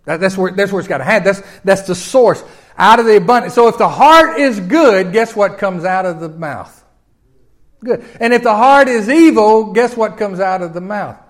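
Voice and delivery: loud, high preaching build; rising sermon fervour. Acted. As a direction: push the congregation toward the answer.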